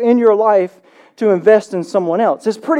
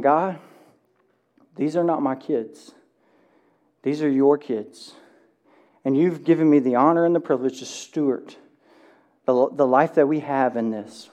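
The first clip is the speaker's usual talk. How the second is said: hushed prayer; 7 semitones lower, 7 dB quieter than usual. Retold or imitated. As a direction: pray it gently, almost under your breath. Recalled prayer over newborns.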